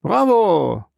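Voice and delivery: high, drawn-out cheer. A narrator's reading. Captain and sailors applaud Dantes's steering, cheering. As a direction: call out your approval. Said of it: "riefen der Kapitän und die Matrosen".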